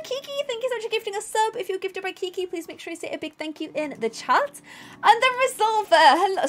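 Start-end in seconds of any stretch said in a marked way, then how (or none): 5.0-6.5 name called high, dropping mid-word; voice drops low. Real, not acted. Streamer thanking a gifter, voice accidentally drops low. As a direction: let the voice sink partway through the name. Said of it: "my voice went low then".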